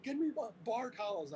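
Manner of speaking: high urgent call; urgent request. Retold or imitated. Telling the bartender to fetch towels, high and urgent. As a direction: demand towels fast.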